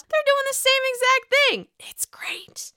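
high hushed whisper; whispered gossip. Pretend. Vlogger whispering gossip to viewers. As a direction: whisper it like a juicy secret.

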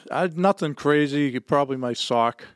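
ordinary delivery

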